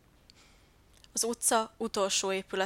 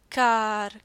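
much louder crow's caw; cawing cry; 8 dB louder than usual. A narrator's reading. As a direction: caw it out loud like a crow. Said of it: "kiáltotta Tóbiás". (hangos varjúkárogás)

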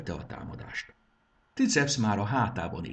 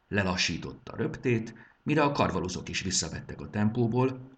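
ordinary delivery

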